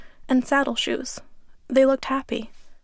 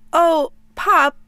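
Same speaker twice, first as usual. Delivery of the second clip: higher, louder cry of the name, mildly tearful; breaking into tears; 5 semitones higher, 6 dB louder than usual. Retold or imitated. Grandson hearing his grandfather say goodbye, a touch of crying. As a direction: voice giving way on the name. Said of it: "and then I started to cry".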